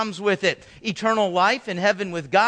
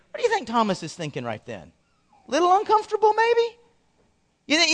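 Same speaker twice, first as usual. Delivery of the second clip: ordinary delivery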